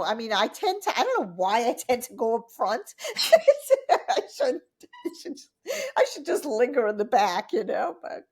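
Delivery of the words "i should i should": words squeezed out through real laughter; laughing. Real, not acted. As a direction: laugh at yourself mid-sentence. Laughing at her own habit.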